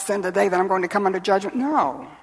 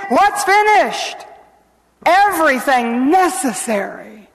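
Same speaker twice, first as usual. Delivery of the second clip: loud, high-pitched sermon climax; full-force preaching; 7 semitones higher, 6 dB louder than usual. Real, not acted. Preacher tells how Jesus cried out, full-force preaching. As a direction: build to a ringing proclamation.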